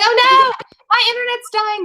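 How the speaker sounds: high, loud mock-panicked cry; high mock panic retelling the internet dropping. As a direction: squeal it like a half-joking alarm. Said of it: "I was like".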